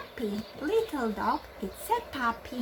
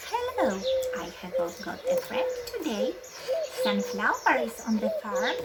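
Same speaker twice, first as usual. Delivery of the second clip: puppet voice; 10 semitones higher, faster than usual. Acted. Teacher voices puppet friend.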